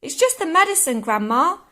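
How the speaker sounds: George reassuring Grandma. Acted. George excusing his medicine to Grandma, high-pitched reassurance.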